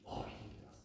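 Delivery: awed whisper. Real, not acted. Preacher to congregation, whispered awe. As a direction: breathe it out in wonder.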